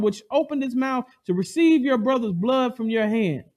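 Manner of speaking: loud, high proclamation of a curse; God cursing Cain; stern judgment. Acted. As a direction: thunder it down as God.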